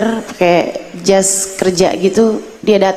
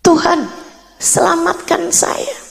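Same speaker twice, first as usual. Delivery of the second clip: desperate prayer; 6 semitones higher than usual. Retold or imitated. Desperate prayer while crying.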